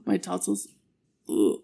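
gagging mid-sentence; dry heaving. Real, not acted. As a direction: talk through a gag reflex. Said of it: "Sorry, I keep gagging".

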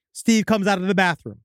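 usual speaking voice

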